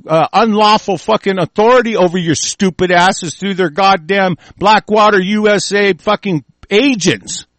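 ordinary delivery

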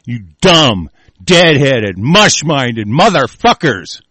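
loud, punched-out contempt; angry insult. Real, not acted. Host berating his political opponents, real anger. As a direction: hammer each insult, dragging out the last.

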